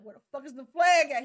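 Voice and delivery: mock Draken barking about the flag; loud mock search; mock Draken yell. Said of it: "He like".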